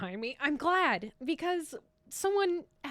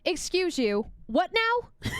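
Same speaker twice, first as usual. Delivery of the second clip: mock offended; 6 semitones higher, 4 dB louder, slower than usual. Indignant reaction to butter tarts.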